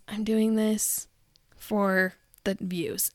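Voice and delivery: mock slow confession. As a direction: spell out the fake motive slowly. Mocking creators' real intent.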